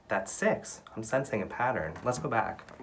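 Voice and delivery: normal talking voice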